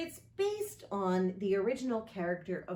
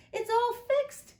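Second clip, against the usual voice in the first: put-on delighted child; 6 dB louder than usual. Lisa sees fixed sign.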